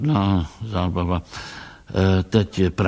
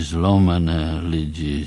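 plain reading voice

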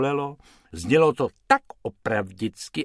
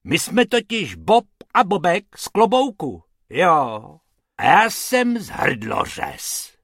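roared; 6 semitones higher, 6 dB louder than usual. Acted. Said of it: "zařval pan Hrubec".

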